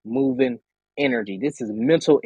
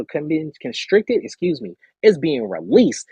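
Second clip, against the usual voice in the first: ordinary talk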